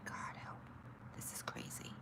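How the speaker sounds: breathy whispered despair under her breath; exasperated whisper. Real, not acted. Frustrated streamer whispering to herself while fixing the stream. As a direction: whisper it to yourself, fed up.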